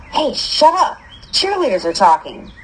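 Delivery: ordinary delivery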